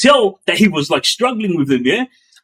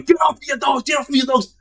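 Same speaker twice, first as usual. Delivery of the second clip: much higher mock yell at the guards; retold angry shout; 10 semitones higher, faster than usual. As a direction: shout to get them off you, imitated. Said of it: "all I could hear was him saying".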